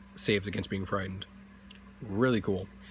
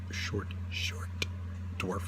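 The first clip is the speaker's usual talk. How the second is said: breathy stage whisper; 5 dB quieter, much slower than usual. Pretend. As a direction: whisper the joke aside. Whispered punchline about dwarves.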